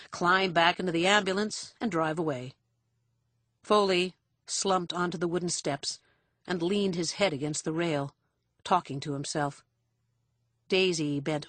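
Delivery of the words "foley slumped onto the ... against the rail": usual speaking voice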